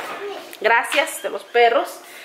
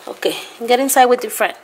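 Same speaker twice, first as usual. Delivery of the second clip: ordinary delivery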